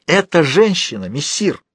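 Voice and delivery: high, spiteful outcry; sudden spite. A narrator's reading. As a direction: cry out with sudden malice, drawn out. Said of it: "выкрикнул Фалуа с неожиданной злобой". (злобный высокий выкрик)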